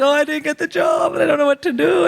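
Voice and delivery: high, hammed-up wail; manic Bollywood crying. Daughter phoning parents after job rejection, melodramatic crying. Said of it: "When I started crying in, like, full Indian Bollywood manic crying".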